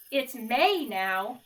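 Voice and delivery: lively picture-book read-aloud; reading to children. A narrator's reading. Teacher reading Frog and Toad to the class, lively storytelling. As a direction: read it like story time for kids.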